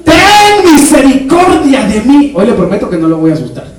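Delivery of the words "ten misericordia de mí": súplica gritada. Retold or imitated. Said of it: "¿Qué le gritó ese ciego?".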